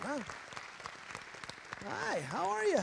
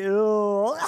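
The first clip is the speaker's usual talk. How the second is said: loud, put-on goofy Ew; a loud, grossed-out country boy grunt; country boy dummy voice; 2 semitones higher, 11 dB louder than usual. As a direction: blurt it like a dopey puppet. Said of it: "no, I do the voice".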